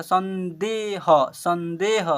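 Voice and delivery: संदेह drawn out slowly as san-dey-ha; slow model reading. Shown as a demonstration. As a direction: stretch each syllable of संदेह. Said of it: "ऐसे पढ़ेंगे". (संदेह का धीमा उच्चारण, सं-दे-ह)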